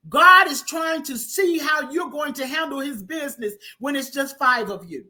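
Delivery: full-force preacher shouting; preaching. Acted. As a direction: drive it home to the congregation. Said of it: "preaching at full force".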